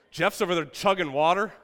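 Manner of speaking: usual speaking voice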